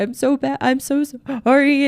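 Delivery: high, emotional imitation of a tearful wife; mock crying apology. Wife confronted by her husband starts crying, mock tearful apology. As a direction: act out a tearful, pleading sorry. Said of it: "she'll get very emotional and start crying".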